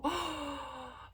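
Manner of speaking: exaggerated gasp, pitched high; theatrical gasp of fake fright; mock shocked gasp. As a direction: gasp dramatically, as if traumatized. Mocking someone acting so traumatized.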